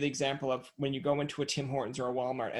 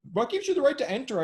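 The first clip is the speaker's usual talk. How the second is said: high, mock indignant; offended homeowner, hammed up; mild mock outrage; 10 semitones higher, 3 dB louder than usual. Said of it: "they're like".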